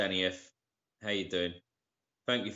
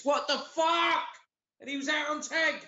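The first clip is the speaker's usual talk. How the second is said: real outrage, shouting at the news; outraged shout. Real, not acted. Reacting to the London Bridge attacker news with an outraged shout.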